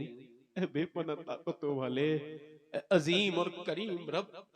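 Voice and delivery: slow, drawn-out supplication; pleading prayer. Acted. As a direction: plead with God slowly and humbly. Mother prays to God. (धीमी, गिड़गिड़ाती दुआ)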